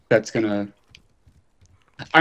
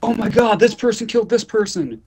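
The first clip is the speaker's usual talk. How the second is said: high, excited blurting of spoilers; mock excited moviegoer; 12 semitones higher than usual. Friend mocking a spoiler-happy moviegoer, high and excited. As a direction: gasp it out like a spoiler-happy viewer. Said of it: "you're like".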